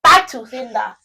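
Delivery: loud, emphatic Nigerian mother; hammed-up motherly indignation; indignant Nigerian mom. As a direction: throw the insult back loudly, as a mom. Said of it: "What do you think the Nigerian mom response would be?".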